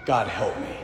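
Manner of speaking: hushed, trembling plea under the breath; whimpering whisper. A narrator's reading. Desperate man begging God in a hushed whimper. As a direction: whimper it softly, barely voiced. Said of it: "the man whimpers in a whisper".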